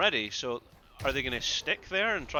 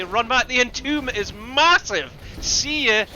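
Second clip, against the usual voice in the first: higher and louder, rising hype; excited shout; 8 semitones higher, 8 dB louder, slower than usual. Real, not acted. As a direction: call the big play with full energy. Caster hypes a huge Entomb.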